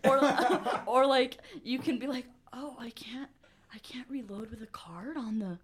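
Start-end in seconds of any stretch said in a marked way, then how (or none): 2.5-5.6 soft, half-whispered feigned helplessness; mock helpless excuse. Rider excusing themself to the driver, mock helpless. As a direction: act out a quiet, whispery little excuse.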